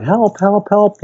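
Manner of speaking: high-pitched imitation of someone freaking out; mock panicked cry. Imitating Alice drowning in the Pool of Tears, panicked. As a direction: retell frantic cries for help, pitch jumping up. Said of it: "she's essentially freaking out".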